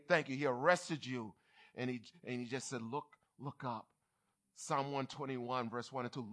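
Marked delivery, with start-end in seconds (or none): 2.8-3.9 low, soft voiced urging; gentle redirection. Holy Spirit calming the one just stopped, low and soft. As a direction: softly turn their attention upward.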